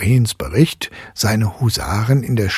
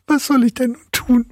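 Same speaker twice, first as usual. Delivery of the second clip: put-on tearful girl. A narrator's reading.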